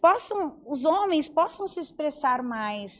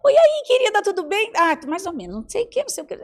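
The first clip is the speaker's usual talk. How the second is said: much higher, louder bright greeting; chirpy salon chat; 5 semitones higher, 4 dB louder, faster than usual. Retold or imitated. Woman greeting her manicurist, chirpy and higher pitched. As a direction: gush like chatting at the salon. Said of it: "conversando com a manicure".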